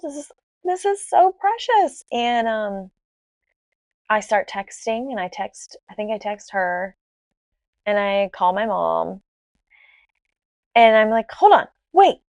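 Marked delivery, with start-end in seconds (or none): 0.0-2.0 high, cooing retold delight; re-enacted giddy gushing. Retold joy at both having girls. 11.3-12.2 high, loud interjection; retold sudden hold-on; urgent halt. Retold sudden halt before telling everybody.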